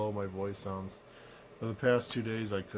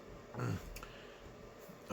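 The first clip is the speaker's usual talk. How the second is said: throat clearing; 10 dB quieter than usual. Real, not acted. Sick, throat hurts.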